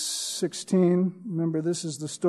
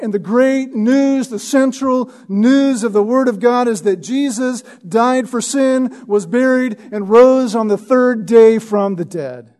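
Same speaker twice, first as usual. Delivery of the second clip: louder, higher gospel proclamation; proclaiming; 5 semitones higher, 7 dB louder than usual. Acted. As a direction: announce the great news boldly.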